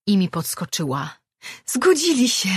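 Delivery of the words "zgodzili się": high, loud joyful cry; excited outburst. Acted. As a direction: burst out with delight at the news. Imi podskakuje z radości. (radosny, wysoki, głośny okrzyk)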